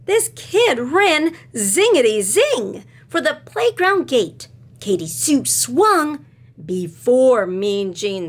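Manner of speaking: slow, sing-song read-aloud; reading to children. A narrator's reading. Teacher reading a picture book to her class, sing-song. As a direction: read it with rhythm for little listeners.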